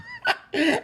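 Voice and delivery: short high-pitched laughing burst; high laughter. Real, not acted. Host laughing at his co-host's despair, high laughter.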